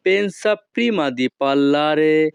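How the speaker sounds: each Italian word spaced out very slowly; slow teaching model. Shown as a demonstration. Teacher models Italian phrase for students, very slow. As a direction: spell it out slowly for learners to copy. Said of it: "Italian ভাষা".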